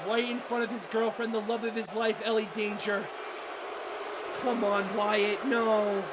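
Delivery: low, very soft pleading; hushed, sinking dismay; crestfallen murmur. Real, not acted. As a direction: watch helplessly, the voice drained. Commentator watches favourite being beaten.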